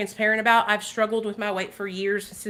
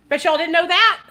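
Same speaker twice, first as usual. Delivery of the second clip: high, louder gloating tease; teasing; 7 semitones higher, 6 dB louder than usual. Real, not acted. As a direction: gloat playfully to viewers. Teasing reveal to viewers.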